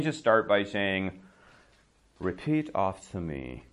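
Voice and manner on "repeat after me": slow, deliberate TV teacher voice; over-enunciate like a TV language class; mock classroom announcer, slow; TV English teacher. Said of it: "This is what they say on T V".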